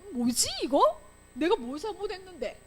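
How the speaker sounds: high, puzzled self-questioning; bewildered protest. Acted. Teacher voices a child unfairly punished, acted bewilderment. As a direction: wonder aloud what you did. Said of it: "이렇게 되는 거야".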